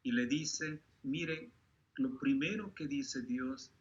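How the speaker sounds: ordinary talk, nothing marked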